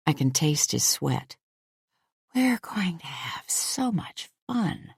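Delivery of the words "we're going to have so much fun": slow, breathy high whisper; teasing whisper. A narrator's reading. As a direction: whisper it with a playful tease. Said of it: "I whisper".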